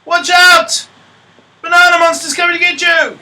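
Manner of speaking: high, loud warning shout; yelled warning. Acted. Teacher acts a pony warning her friend, yelling. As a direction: shout it as an urgent alarm from afar. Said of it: "And she yells out".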